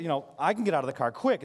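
usual speaking voice

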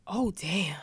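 whispered mock awe; imitated whisper of amazement; hushed aside. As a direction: breathe it out impressed. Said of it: "she was like".

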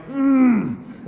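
high strained frustrated mm; frustrated hum. Retold or imitated. Stuck on bad Wi-Fi, a high frustrated hum. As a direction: squeeze out a high tense hum.

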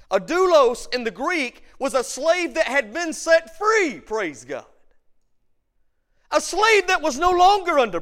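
loud, high, rousing sermon voice, building to shouts; a preacher stirring up the church, full force; full-force preaching. Acted. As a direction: preach it out over the congregation with fire.

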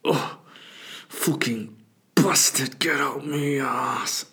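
breathy grunts, then slow drawn-out curse; straining English guy. Retold or imitated. English stranger battling his poo, strained groaning. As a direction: push the words out with effort. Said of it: "He's just going".

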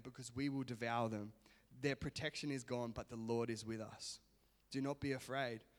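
soft, even Bible reading voice; scripture reading. Acted. Pastor reading Numbers to the church, soft reading. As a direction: read the passage quietly to the congregation. Reads scripture passage aloud.

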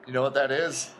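normal talking voice

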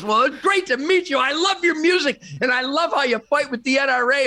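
high-pitched mock gushing fan; excited retold fan greeting. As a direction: imitate a starstruck fan, pitch jumping up. Acts out a delighted fan.

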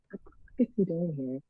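quiet, quick aside to herself; muttered thought. Retold or imitated. Irritated woman beside a silent date, muttered to herself.

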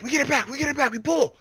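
high-pitched team effort cries; acted urgent hauling. Party drags the rope back together, high urgent calls. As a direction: imitate a party heaving urgently.

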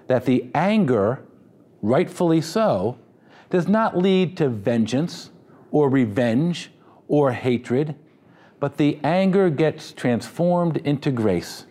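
measured, deliberate phrasing; slow emphasis. Real, not acted. Pastor slowly repeating his key phrase for the congregation. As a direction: drag each phrase out for weight. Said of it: "I hope you remember that phrase".

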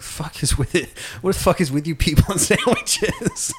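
put-on drunk slur. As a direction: barely get the words out, drunk. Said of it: "he can barely talk".

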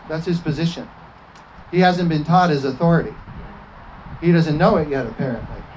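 normal talking voice

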